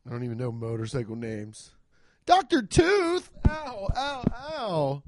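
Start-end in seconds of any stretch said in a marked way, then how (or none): none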